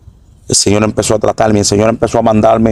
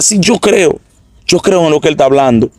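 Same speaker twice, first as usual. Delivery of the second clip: much higher, fervent declaration; excited fervent belief; 6 semitones higher than usual. Retold or imitated. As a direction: cry out your faith at a high pitch. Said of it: "desperté dando gritos locos".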